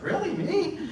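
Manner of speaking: high squeaky laughing "really, me?"; surprised disbelief. Retold or imitated. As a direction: play it like you can't believe you were picked. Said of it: "and I was like".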